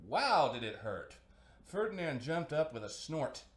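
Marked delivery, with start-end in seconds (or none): 0.1-1.2 exclaimed. A narrator's reading. Bee sting exclamation.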